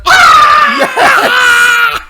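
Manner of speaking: high loud mock scream; hammed-up child shriek; kids screaming. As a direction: imitate a shrieking kid, laughing. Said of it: "Just going".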